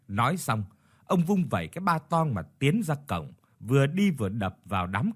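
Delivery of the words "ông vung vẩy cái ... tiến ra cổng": ordinary delivery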